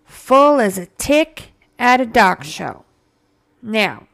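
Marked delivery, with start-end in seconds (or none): 0.0-2.8 louder, slower, lean on Full; emphatic repeat. Shown as a demonstration. Host repeating the phrase for her listeners, slow and emphatic. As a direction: punch "Full" and spell it out slowly. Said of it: "You heard me".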